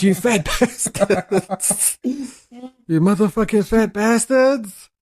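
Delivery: slow, high, drawn-out mocking voice; mock government insult. Mocking the government to her co-host, slow and high. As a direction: stretch each insult out in a put-on sneer. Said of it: "They said".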